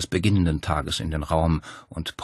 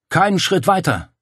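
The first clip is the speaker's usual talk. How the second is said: higher, louder shout over the din; bellowed warning; 11 semitones higher, 6 dB louder than usual. A narrator's reading. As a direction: roar it to stop the men in their tracks. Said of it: "sie brüllte".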